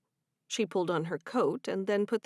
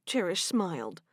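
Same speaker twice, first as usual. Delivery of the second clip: ordinary delivery, nothing marked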